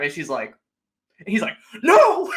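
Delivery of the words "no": much higher, much louder "No!"; hammed-up panicked shout; mock shout. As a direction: yell it in panic. Piper refusing, throws her off.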